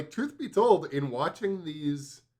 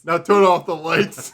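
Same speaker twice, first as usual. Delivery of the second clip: laughing; 4 semitones higher, 11 dB louder than usual. Retold or imitated. Repeats the intro line, laughing.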